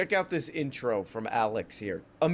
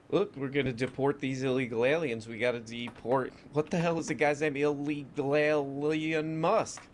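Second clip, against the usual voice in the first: ordinary talk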